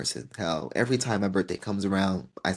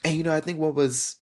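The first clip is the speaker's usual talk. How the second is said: usual speaking voice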